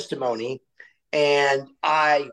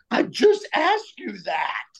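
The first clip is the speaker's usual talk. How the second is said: higher, slowed indignant retort; mock outburst; 7 semitones higher, slower than usual. Student to teacher after failing, slowed higher indignant retort. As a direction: snap it back at her, higher and slow. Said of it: "In that voice".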